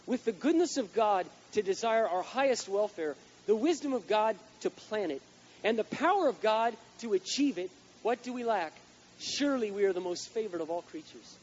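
slow, high, loud preaching voice; preaching quote. Acted. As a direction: proclaim it from the pulpit. Reads Tozer quote in preaching.

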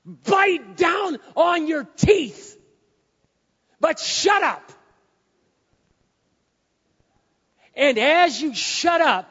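high, slow full-force yell; shouted exhortation. Real, not acted. Preacher urging congregation to hold their tongue, shouting. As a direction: holler each word like a command.

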